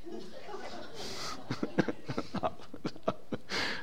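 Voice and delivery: airy high-pitched giggle; high breathless laugh. Real, not acted. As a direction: laugh it out, caught by the joke. Laughs at his own joke.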